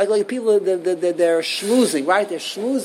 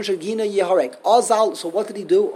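ordinary talk, nothing marked

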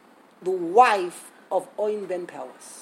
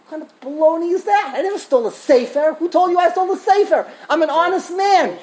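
loud, indignant protest, slowed on the opening; outraged rabbi; 6 semitones higher, 4 dB louder than usual. Retold or imitated. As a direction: bristle with disbelief at the accusation. Rabbi denies stealing again.

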